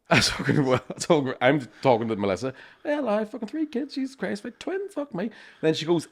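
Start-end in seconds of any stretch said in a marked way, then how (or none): 2.9-5.3 mock high voice. Mocking himself to Melissa.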